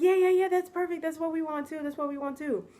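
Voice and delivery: high, rushing-to-please imitation; acted-out gushing approval; eager agreement. Acting hosts' eager agreement.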